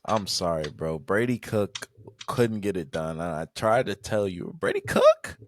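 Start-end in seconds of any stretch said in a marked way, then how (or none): 4.6-5.4 high, loud questioning squawk; incredulous outburst. Real, not acted. Host questioning his co-host's quarterback, incredulous outburst. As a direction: shoot the name up in disbelief.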